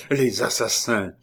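narrated outcry; exclaiming. A narrator's reading. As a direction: cry out against them, restrained. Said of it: "s'écria le docteur".

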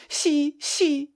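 put-on high-pitched, fierce child's retort; wild little contradiction; fierce insistence. A narrator's reading. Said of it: "répliqua farouchement le gamin".

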